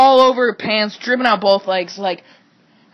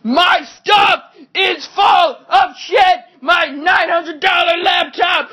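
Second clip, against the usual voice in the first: higher, slow, drawn-out enraged yell; outraged yell; 8 semitones higher, slower than usual. Retold or imitated. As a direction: scream it like your laptop is ruined. Retelling his own furious reaction.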